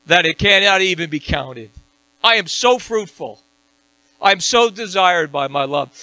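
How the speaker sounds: loud, high fiery preaching; high, loud fervent sermon; preaching at full force. Acted. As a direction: drive it home like a revival preacher. Preaching climax.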